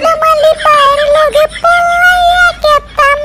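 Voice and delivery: very high-pitched wailing wife voice; lamenting wife. Acted. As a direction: complain in a high put-on voice, stretching the phrase ends. Wife lamenting her lazy husband.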